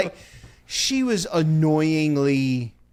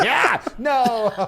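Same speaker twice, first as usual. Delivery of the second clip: mock yell; 7 semitones higher than usual. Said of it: "I'm not gonna yell at bears anymore".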